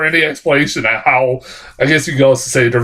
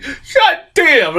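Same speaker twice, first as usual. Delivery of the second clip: laughing exclaim. Retold or imitated. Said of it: "I'm like".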